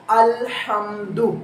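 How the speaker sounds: अल्हम्दु drawn out slowly, glottal onset; slow recitation. Shown as a demonstration. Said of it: "जैसे".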